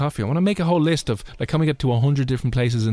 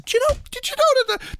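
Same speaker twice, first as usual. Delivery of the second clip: mock know-it-all. Imitating tourists repeating pub fact.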